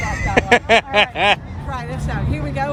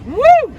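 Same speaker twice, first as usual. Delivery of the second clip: high, loud "woo"; excited whoop; 8 semitones higher, 9 dB louder than usual. Pretend. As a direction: let out a piercing cheer. Hyped street performer cheering.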